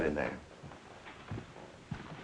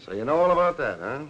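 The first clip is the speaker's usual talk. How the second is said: louder, higher angry challenge; lieutenant rounding on the publisher, louder; sharp retort; 7 semitones higher, 7 dB louder than usual. Acted. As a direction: snap it back, caught off guard.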